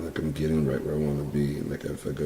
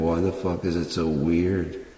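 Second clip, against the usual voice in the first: ordinary delivery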